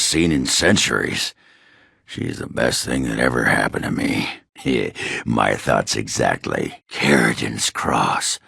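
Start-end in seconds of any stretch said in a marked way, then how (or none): 4.6-5.2 a short, soft laugh. Acted. Dwarf laughs, thinking of Branka, soft short laugh. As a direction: let a quiet laugh slip out.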